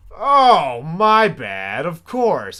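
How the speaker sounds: high, loud sarcastic outburst; loud sarcasm. Real, not acted. Mocking the brother's excuse, loud sarcasm. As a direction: throw the apology back sarcastically.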